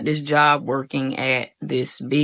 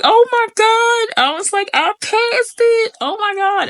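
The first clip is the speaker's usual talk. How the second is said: overjoyed disbelief; 5 dB louder than usual. Retold or imitated. Just passed the math test, high overjoyed squeal.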